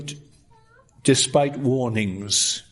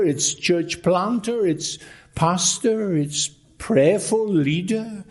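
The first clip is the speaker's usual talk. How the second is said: preaching emphasis; 5 semitones higher than usual. Acted. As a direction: build each title like a sermon climax. Preaching builds over the founders.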